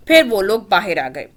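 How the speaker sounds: plain reading voice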